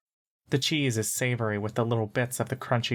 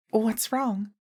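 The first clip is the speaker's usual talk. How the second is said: put-on much higher little-girl voice; high-pitched girl; 10 semitones higher than usual. A narrator's reading. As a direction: ask it in a high, girlish pitch. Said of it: "and high-pitched".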